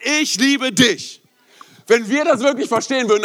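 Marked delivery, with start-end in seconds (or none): 0.1-1.2 high, very slow tender words; Jesus telling you he loves you, very slow and tender. Acted. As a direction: say it like a whispered promise of love. Jesus says I love you.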